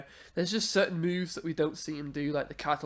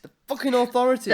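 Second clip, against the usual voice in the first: angry; 6 semitones higher, 7 dB louder, faster than usual. Real, not acted. Ranting about the show.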